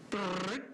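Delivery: high rising imitated zoom noise; jet whoosh sound. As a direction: make the jet zip across the screen. Imitating jet on radar screen.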